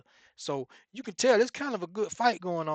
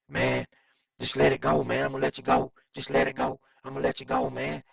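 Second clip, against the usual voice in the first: Ken pleading; 3 semitones lower, 3 dB louder than usual. Retold or imitated. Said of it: "you can hear Ken saying".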